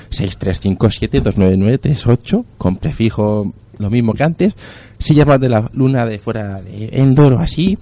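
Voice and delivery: usual speaking voice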